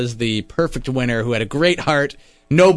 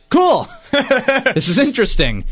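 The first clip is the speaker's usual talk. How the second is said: high, louder, giggling delight; giddy excitement; 12 semitones higher, 4 dB louder than usual. Retold or imitated. Host recalling his excited reaction to Shane's demand, high and giggling. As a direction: squeal it with laughter in the middle. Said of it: "And I was like".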